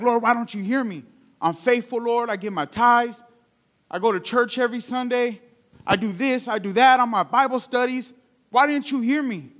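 acted pout, lifted in pitch; pouting complaint. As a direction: imitate an indignant believer protesting. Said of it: "they go and pout".

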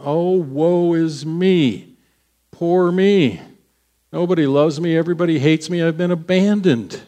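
hammed-up poor-me lament; mock self-pity. Mocking Elijah feeling sorry for himself, whiny.

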